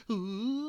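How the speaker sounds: a high, hollow ghostly ooh; ghost impression. Host doing a ghost for the listeners, ghostly ooh. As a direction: moan like a spooky ghost. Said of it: "That's my ghost impression".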